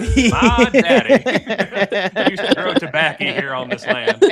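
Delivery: put-on slow, high old-timer drawl with a laugh in it; hammed-up Southern old man; mock Southern drawl. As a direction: play a porch-sitting Southern grandpa. Joking Southern porch character.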